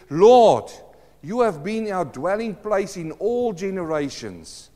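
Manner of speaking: loud, ringing call on Lord, then a swelling read; proclaimed scripture. Acted. Preacher reading Psalm ninety to the church, loud and proclaiming.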